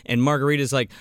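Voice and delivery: normal talking voice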